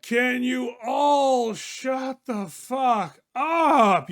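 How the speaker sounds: loud, drawn-out, high angry yell; angry yell from couch. Acted. Verge, woken on the couch, yells at the roommates to shut up. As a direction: bellow it from the couch, furious at the noise. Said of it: "You hear from the couch".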